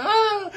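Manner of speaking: high, loud hammed-up scream with a laugh; cartoon yelp of pain, giggling; mock scream. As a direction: shriek like the bleeding lady, half laughing. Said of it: "She's like".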